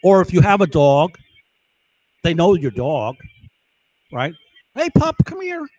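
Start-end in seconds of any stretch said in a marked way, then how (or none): 4.8-5.7 much higher, sing-song call to a pup; calling a dog. Retold or imitated. Intruder sweet-talking the homeowner's dog, high coaxing call.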